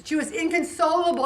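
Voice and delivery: usual speaking voice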